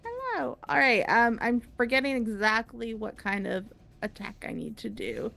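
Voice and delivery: ordinary delivery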